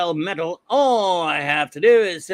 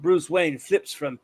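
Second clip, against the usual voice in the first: normal talking voice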